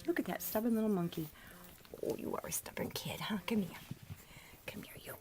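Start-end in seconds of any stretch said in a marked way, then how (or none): 2.1-3.2 fond whisper. Real, not acted. Owner whispering to a stubborn puppy. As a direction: tease the pup in a whisper.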